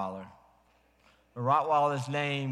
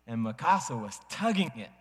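ordinary talk

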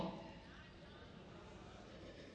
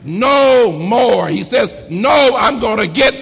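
much higher and louder, crying shout; preaching at full force; 7 semitones higher, 6 dB louder, slower than usual. Acted. Preacher shouting the promises over the organ, full-force whoop. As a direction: whoop it to the top.

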